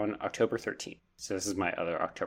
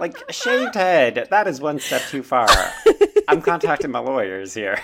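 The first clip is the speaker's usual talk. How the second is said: mock outrage; 6 semitones higher, 12 dB louder than usual.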